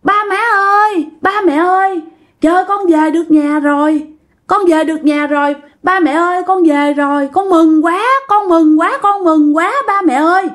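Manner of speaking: put-on high, mincing girlish voice. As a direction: play a possessed man sounding like a dainty girl. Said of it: "cũng yểu điệu như là một người con gái". (giọng yểu điệu như con gái)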